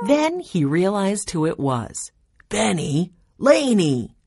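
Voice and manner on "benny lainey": exclaimed recognition of the pranksters; exclaiming. Acted. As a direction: call out each name as you realize who it is. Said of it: "he exclaimed".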